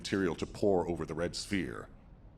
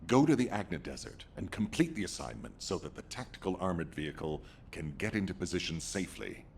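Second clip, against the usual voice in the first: ordinary talk, nothing marked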